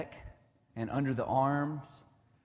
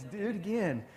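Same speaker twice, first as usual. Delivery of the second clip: lightly voiced small boy begging, higher; a hint of a breathless kid asking for more; eager little boy; 9 semitones higher than usual. Dad imitating his son begging for more tickles, lightly childlike. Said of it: "But then they get their breather".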